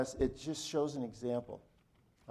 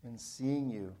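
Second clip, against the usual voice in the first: closing prayer; 3 semitones lower, 4 dB quieter than usual. Real, not acted. Pastor praying for his struggling congregation, subdued and reverent. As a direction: pray unhurriedly, voice settling low and soft at phrase ends.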